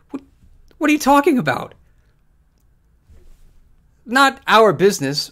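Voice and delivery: can't believe what they're saying; incredulous outburst. Real, not acted. Incredulous rant.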